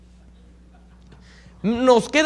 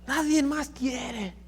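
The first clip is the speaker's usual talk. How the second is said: soft sad remark; 5 semitones higher, 7 dB quieter, much slower than usual. Retold or imitated. Sister says nobody else wants.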